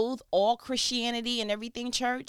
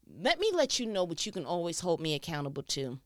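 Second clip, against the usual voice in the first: usual speaking voice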